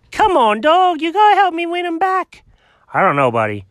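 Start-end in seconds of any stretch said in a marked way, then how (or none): 0.1-2.4 high pleading cat voice, rising. Acted. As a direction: beg your buddy for help up high.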